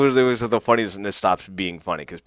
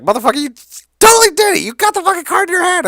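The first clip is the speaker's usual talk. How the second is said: much higher, louder squealing rant; hammed-up outrage, voice cracking high; high-pitched mock fury at Petey; mock angry accusation; 10 dB louder, faster than usual. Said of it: "Sanjay's like".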